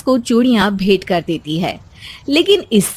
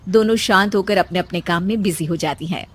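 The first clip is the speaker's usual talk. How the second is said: ordinary talk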